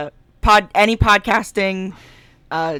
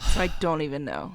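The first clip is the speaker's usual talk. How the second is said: whispering; 4 semitones lower, 9 dB quieter than usual. Real, not acted. Quiet aside after whisper.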